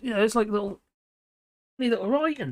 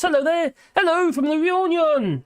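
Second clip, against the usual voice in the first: higher, louder bright hello; cheery greeting; 8 semitones higher, 4 dB louder than usual. Real, not acted. Streamer greeting a viewer from Reunion, higher and cheery.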